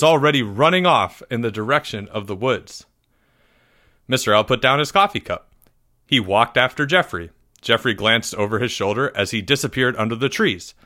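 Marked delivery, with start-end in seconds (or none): none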